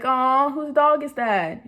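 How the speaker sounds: cooing at a photo. Retold or imitated. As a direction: melt over a cute dog picture.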